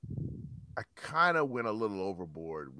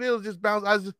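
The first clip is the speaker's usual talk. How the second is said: usual speaking voice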